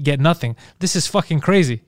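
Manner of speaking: normal talking voice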